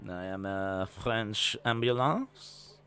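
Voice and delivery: slow, low, mock French accent. Playing a French ambulance, slow mock French accent. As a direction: speak as the ambulance in French English. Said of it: "That is my impression of a French ambulance".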